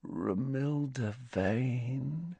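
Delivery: very slow, soft, dreamy sigh; hushed, lingering lovestruck murmur; softly. A narrator's reading. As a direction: breathe the name out adoringly, drawn long. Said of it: "said Ron softly".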